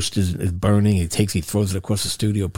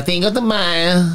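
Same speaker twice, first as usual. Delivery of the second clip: higher, slow mock Spanish "Cinco de Mayo"; 7 semitones higher, slower than usual. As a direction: drawl out "Cinco de Mayo" like Ed does. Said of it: "Ed likes to say it with the".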